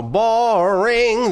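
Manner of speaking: "boring" stretched long and loud; drawn-out "boring". Real, not acted. Host apologizing for dozing off in own intro, drawn-out "boring". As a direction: belt out a long, high "boring".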